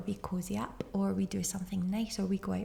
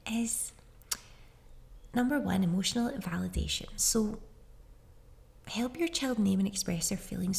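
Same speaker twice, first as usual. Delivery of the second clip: ordinary delivery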